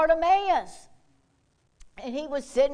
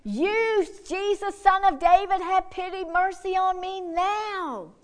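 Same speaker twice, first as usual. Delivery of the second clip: higher, pleading cry for mercy; retold shouting; 6 semitones higher than usual. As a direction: beg at the top of his voice. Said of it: "all the more he kept on shouting".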